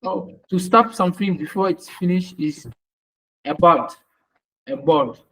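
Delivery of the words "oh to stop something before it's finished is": ordinary talk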